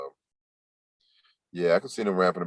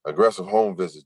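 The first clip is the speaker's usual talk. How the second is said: usual speaking voice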